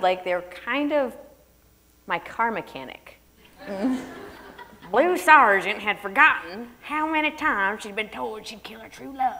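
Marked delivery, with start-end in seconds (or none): 4.9-9.4 a put-on Southern accent as a joke; fake Southern accent. Shown as a demonstration. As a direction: parody a car-mechanic Southern accent. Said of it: "I'm not gonna read it like that".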